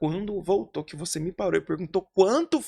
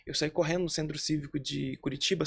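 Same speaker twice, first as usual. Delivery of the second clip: ordinary talk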